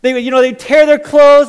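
ordinary talk, nothing marked

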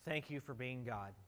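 hushed pastor's prayer; leading prayer. Acted. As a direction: pray aloud for the congregation. Leads the congregation in prayer.

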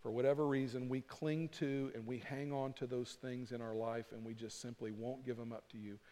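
hushed, earnest appeal to God; closing prayer. Acted. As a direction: pray quietly on the congregation's behalf.

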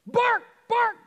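high shouted bark; high yappy dog bark. Acted. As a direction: yap it out like a dog. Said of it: "the dog said".

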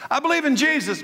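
high, lightly voiced smug believer; mock churchgoer. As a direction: parrot the easy answer back at them. Mimics a complacent churchgoer.

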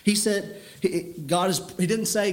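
normal talking voice

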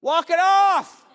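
loud, high dismissive holler; brusque parent call. Acted. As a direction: call it out to a kid who is faking, drawn out. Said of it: "when it's the fake boo-boo cry, you're like".

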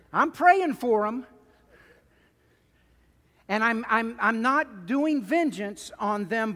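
loud, high, exasperated plea to God; pleading prayer. Acted. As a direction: cry out to God like David, impatient. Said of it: "a little like David".

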